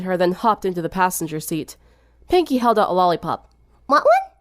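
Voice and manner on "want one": very high, chirpy offer; bouncy party girl holding out candy; high, sing-song girl voice. A narrator's reading. Pinkie offers a lollipop.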